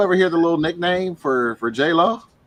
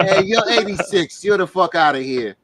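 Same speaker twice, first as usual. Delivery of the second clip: louder, lightly played casino enforcer; mock pit boss; 5 semitones higher, 5 dB louder than usual. Joking as a pit boss ejecting a gambler, mock-tough and loud. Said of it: "You need a virtual pit boss".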